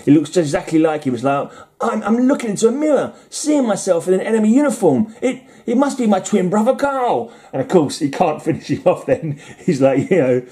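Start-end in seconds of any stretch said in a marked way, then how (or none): 1.8-7.3 high-pitched, hammed-up disbelief; mock astonishment. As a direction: act stunned, pitch shooting way up. Acting pilot's shock at seeing twin.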